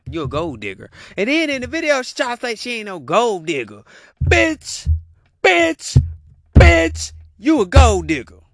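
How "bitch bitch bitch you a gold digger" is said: higher, much louder repeated bitch; loud rant. Real, not acted. Ranting at the woman who denies being a gold digger, loud. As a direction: hammer each word louder.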